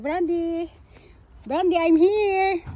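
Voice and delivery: high call, drawn out slowly; long high holler to a pet; calling the dog. Real, not acted. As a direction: call out to your dog, stretching the words.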